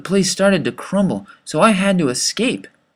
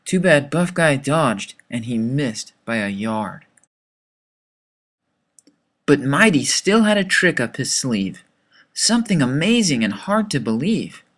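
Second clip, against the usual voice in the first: ordinary delivery, nothing marked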